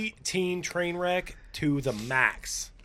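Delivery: dragging out preteen train wreck slowly; slow drawn-out emphasis. Real, not acted. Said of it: "Especially when you say preteen train wreck".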